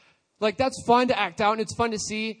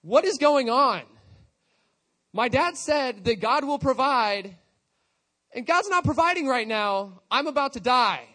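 mock freaking out; 5 semitones higher, 5 dB louder than usual. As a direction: play a boy realizing he's in danger. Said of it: "Isaac's probably freaking out".

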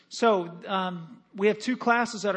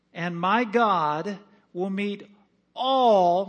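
slow build, then "all," hit louder; with emphasis; 4 dB louder, much slower than usual. Shown as a demonstration. Pastor to congregation, slow stress on "all,". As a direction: draw the verse out and land on "all,". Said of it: "read it with some emphasis".

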